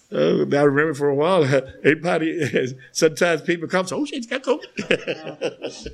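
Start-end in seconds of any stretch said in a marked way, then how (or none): 4.0-5.9 high, soft, slow laughing quote; laughing mock alarm. To the NA meeting, mocking people's fear, laughing. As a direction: mimic a scared whisper through laughter.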